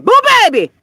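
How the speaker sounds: squealing, high and loud; excited squeal. Real, not acted. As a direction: shriek it with glee. Excited call.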